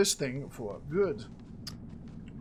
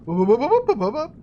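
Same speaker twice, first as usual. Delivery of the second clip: playful wup noises; 5 semitones higher, 9 dB louder than usual. Real, not acted. Vocal noise while the loot pops.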